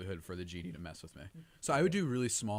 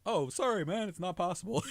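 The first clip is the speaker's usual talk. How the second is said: apologetic genie; 8 semitones higher than usual. Pretend.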